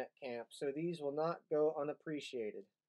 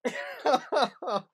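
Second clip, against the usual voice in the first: burst of laughter; 7 semitones higher, 7 dB louder than usual. Real, not acted. Reacts to harsh insult.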